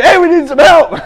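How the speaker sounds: calling for help. Retold or imitated. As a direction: shout across the room for backup. Calling for help across room.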